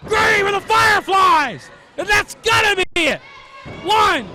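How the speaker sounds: excited high play-by-play, building; wrestling commentator. Real, not acted. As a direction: call the near falls with rising hype. Live play-by-play of near falls.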